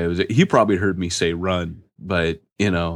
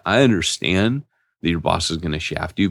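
ordinary talk

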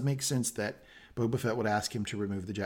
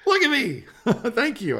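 laughing pride; 13 semitones higher, 6 dB louder than usual. Real, not acted. Proud of his accent being praised.

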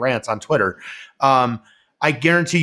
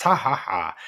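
spoken ha-ha-ha imitating a friend; mock laugh; 4 semitones lower, 4 dB quieter than usual. Host retelling Denzel's podcast joke, mock laugh. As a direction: imitate a joker's laugh, lower and in separate syllables.

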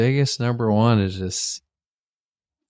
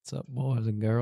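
put-on country boy accent; 7 dB quieter than usual. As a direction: greet them in a soft country-boy twang. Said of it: "kind of voice in your talk like a country boy".

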